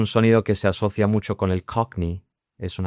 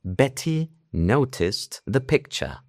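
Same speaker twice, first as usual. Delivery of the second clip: slow, precise RP with every T pronounced; posh British RP; 5 semitones higher, much slower than usual. Shown as a demonstration. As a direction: textbook British accent, pitched up and over-articulated. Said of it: "todo perfecto, la T".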